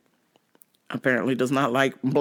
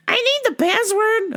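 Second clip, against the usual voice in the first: teller voice. Retold or imitated. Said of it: "She's like".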